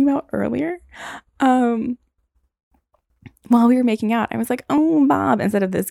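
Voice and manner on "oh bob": higher, louder breathy "Oh, Bob"; mock moan. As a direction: sigh it out like a swoon. Moaning wrong name.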